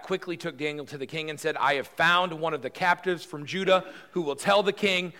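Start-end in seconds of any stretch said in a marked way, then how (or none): 1.6-5.1 self-important official. Acted. Arioch presenting Daniel to king.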